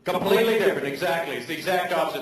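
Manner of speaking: usual speaking voice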